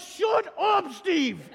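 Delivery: high, loud, drawn-out "shut up"; hammed-up group exasperation; mock angry shout. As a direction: bellow down the annoying colleague. Angels shout down Steve again.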